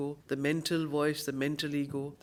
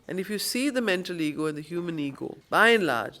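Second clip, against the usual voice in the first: usual speaking voice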